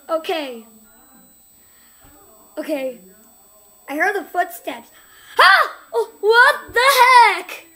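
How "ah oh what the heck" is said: high loud cry, then slow outraged; real fright; startled scream. Real, not acted. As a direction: shriek then gasp it out. Jump scare.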